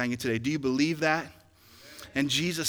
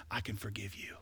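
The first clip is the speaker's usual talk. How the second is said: whispered and softer; a hushed whisper of I can love you; 6 semitones lower, 13 dB quieter than usual. Pretend. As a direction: breathe it, no voice. Whispered line of inner monologue.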